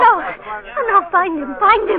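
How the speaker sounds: panicked urgent cry; desperate plea. Real, not acted. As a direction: beg them to catch him. [name] begs them to chase him.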